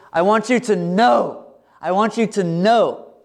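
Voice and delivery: "know" drawn out in a high, slow, insistent phrase; emphasis on know. Acted. God telling his children they are his, emphatic "know". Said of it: "Emphasis on the word know".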